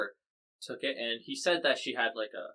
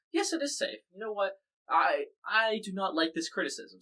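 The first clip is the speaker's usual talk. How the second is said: lightly acted, higher-pitched defensive retort; a hint of an indignant leader, pitched up; Putin; 8 semitones higher than usual. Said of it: "And so Putin said".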